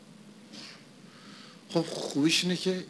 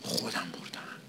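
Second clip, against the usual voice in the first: whispered aside; 5 semitones lower, 8 dB quieter than usual. Real, not acted. Preacher confiding to the congregation, in a whisper. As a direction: drop to a breathy whisper for the point. Preacher stressing one's own deeds.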